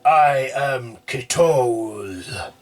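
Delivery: slow, loud growled name; fierce demon roar. A narrator's reading. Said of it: "as he lets out a fierce roar".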